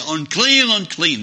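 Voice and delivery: high, loud call through cupped hands; hollered warning. Acted. Lepers warning people away, loud hollered cry. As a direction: call it out like a megaphone. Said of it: "holler out and say".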